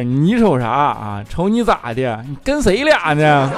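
slow, drawn-out tough Northeastern question; a half-joking slow Northeastern 你跟谁俩呢; slow Northeastern challenge. Shown as a demonstration. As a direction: stretch each word like a Northeastern bruiser sizing you up. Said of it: "正常的语气是".

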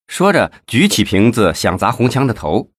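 ordinary delivery, nothing marked

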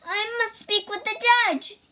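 high, tearful plea; crying out. Acted. Lady Ku pleads at the courthouse, tearful. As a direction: burst in begging through tears. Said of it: "she cried".

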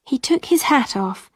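plain reading voice

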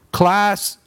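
lightly voiced teacher calling the class; high call to the class. A narrator's reading. Teacher greets her class with a high call.

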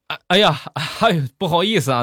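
high, hurried mock-nurse voice; lightly acted embarrassed nurse; flustered apology. Nurse flustered by her mistake.

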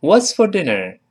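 "What's for dinner" linked and natural; full model read. Shown as a demonstration. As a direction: say the whole line smoothly. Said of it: "我们完整的读一下这句话". (完整示范读)

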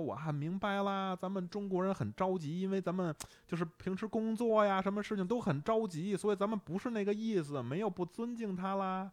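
mimicking a Hong Kong attendant's comforting accented Mandarin; Hong Kong auntie.

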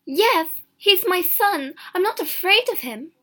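high, loud, impassioned mother; defiant answer. A narrator's reading. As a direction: insist fiercely on each phrase. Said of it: "she answered".

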